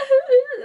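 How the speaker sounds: high, loud burst of crying laughter; sudden sob-laugh burst. Retold or imitated. Mother breaks down after Snow thanks her, loud high sob. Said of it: "just starts crying".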